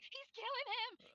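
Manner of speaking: put-on high, soft, drawn-out French-accented cry; imitation French accent, thin and high; Delacroix, French accent. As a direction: mimic a panicked little Frenchman, squeaky and hushed. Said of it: "in his little French accent".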